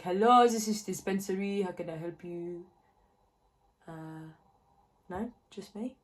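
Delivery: phone greeting in a mock French accent. Answering colleagues' calls, in a mock French accent. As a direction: answer the line like a French receptionist. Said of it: "I do the French accent quite a lot".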